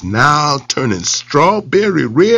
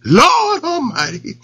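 rabbit yell; 9 semitones higher, slower than usual. Acted. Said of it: "he yelled".

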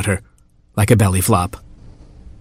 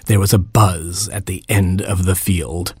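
plain reading voice

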